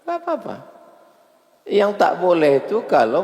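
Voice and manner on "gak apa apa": even higher, squeakier shrug; high mock Egyptian. Preacher mimicking an unbothered Egyptian, very high. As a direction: squeal it off as no big deal. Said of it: "Gitu dia".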